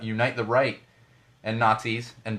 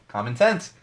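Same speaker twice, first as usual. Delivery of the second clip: normal talking voice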